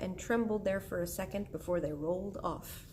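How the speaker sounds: plain reading voice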